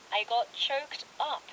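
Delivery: slow, clear recap read. Shown as a demonstration. Host recapping the phrase slowly for listeners. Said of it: "다시 한번 리캡 해드릴게요".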